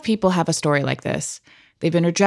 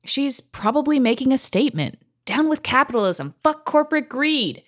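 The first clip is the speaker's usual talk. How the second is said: put-on excited teen cheer; 6 semitones higher than usual. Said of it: "I was like".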